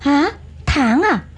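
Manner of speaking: feigned surprise. Acted. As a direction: play dumb, eyes wide.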